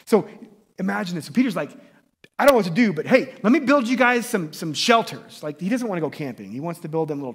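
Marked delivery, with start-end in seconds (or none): 2.4-5.4 louder, higher, blurted offer; acted-out burst of eagerness; eager Peter. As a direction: pipe up keenly as an overeager helper. Acting out eager, clueless Peter.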